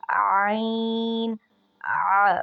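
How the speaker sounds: आइन drawn out, then a loud pressed आ from mid throat; ain throat sound. Shown as a demonstration. Teacher showing the ain sound, tight throat squeeze. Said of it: "ऐसे आवाज़ निकालते हैं".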